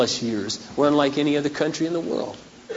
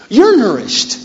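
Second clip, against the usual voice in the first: emphatic declaration; 8 semitones higher, 6 dB louder, slower than usual. Acted. Loud high repeated declaration.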